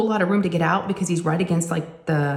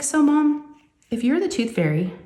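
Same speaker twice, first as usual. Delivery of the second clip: put-on higher, light little girl asking; curious daughter; 5 semitones higher than usual. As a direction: play the curious kid putting it together. Daughter asks about Easter bunny.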